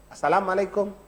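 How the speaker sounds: normal talking voice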